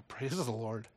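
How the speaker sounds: normal talking voice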